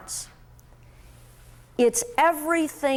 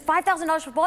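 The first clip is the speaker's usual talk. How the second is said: higher, quicker shocked repeat; shocked outburst; 5 semitones higher, much faster than usual. Retold or imitated. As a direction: blurt it out in disbelief.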